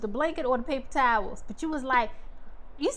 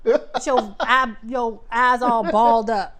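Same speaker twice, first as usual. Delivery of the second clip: slow, louder, laughing; slow laughing tease; 5 dB louder, slower than usual. Real, not acted. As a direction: tease him while laughing. Teasing him, laughing.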